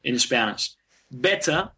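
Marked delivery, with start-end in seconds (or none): 1.1-1.7 British "better" with the final R dropped; British accent. Shown as a demonstration. Teacher contrasting British with American English, British accent. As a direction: end "Better" on an open vowel, British style. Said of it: "I would say that with a British accent".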